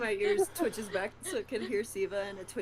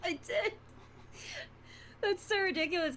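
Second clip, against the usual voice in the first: laughing; 11 semitones higher than usual. Real, not acted. Laughing over own bunny mix-up.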